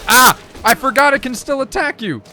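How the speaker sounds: high, loud startled yell; startled shout. Real, not acted. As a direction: yelp in sudden surprise. Surprised by enemy in game.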